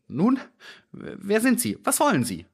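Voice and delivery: high, startled questioning; timid little man caught off guard; unsure voice. A narrator's reading. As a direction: ask nervously, pitched well up. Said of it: "fragte er mit unsicherer Stimme".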